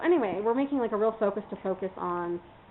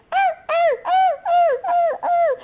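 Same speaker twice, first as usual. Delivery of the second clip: very high, louder yelping puppy whimpers; imitating puppy cries; 7 dB louder, slower than usual. Retelling how the puppy cried after his shots, high yelping imitation. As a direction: mimic a crying puppy's yelps. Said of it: "was climbing up on my shoulders and going".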